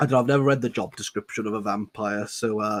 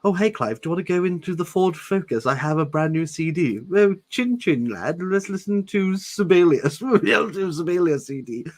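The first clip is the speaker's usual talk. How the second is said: a hint of posh English schoolboys, higher; mock posh lads; 7 semitones higher, 4 dB louder than usual. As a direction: ham up chummy toffs. Mock posh kids ditching.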